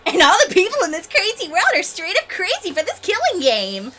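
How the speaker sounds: high, loud, gleeful character voice; manic game villain. Acted. Game villain boasting about a crazy world, high and loud.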